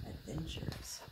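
hushed secretive whisper; whispered aside. Acted. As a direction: breathe it like a secret.

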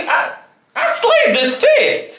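high, slow, drawn-out shout; excited. Real, not acted. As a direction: crow proudly about the outfit. Admiring own outfit in mirror.

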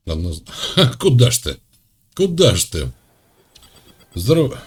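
normal talking voice

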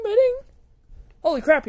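ordinary delivery

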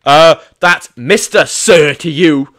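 slow, drawn-out superior tone; mock-stern officer; pompous. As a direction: lord it over the rookie slowly. Pompous commanding officer bit.

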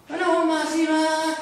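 strained high voice. Shown as a demonstration. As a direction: force it up into the neck. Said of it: "la fuerza de la voz acá".

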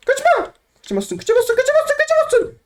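very high sing-song taunt. Real, not acted. Gamer chasing a fleeing player, high sing-song taunt. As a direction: tease them in a high squeal.

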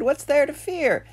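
high, loud bather calling out; shouted question. A narrator's reading. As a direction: call it high across the lake. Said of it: "he shouted".

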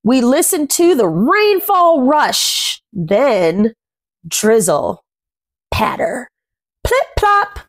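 gentle rhyming picture-book read, words savoured; reading to children. A narrator's reading. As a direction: read the rhyme aloud to little ones. Reads rhyming picture book aloud.

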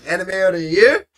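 usual speaking voice

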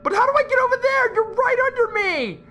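high, rapid squealing; panicked. Real, not acted. Player with monster right under, panicked.

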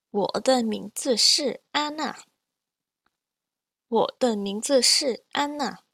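measured, carefully toned Mandarin; deliberate Mandarin with every tone distinct; teacher's model reading. Shown as a demonstration. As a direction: read each line clearly for learners to repeat. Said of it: "I'm going to read each line twice".

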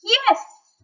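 high, loud eager shout; shouting wolf. A narrator's reading. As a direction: burst out with glee. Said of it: "shouts the wolf".

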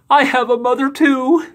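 high wailing sob; crying giant. A narrator's reading. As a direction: blubber it through tears. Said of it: "burst out crying".

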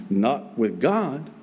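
ordinary delivery

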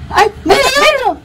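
high, thrilled cry of wonder; excited. Real, not acted. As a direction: call out in delight. Sees hail falling.

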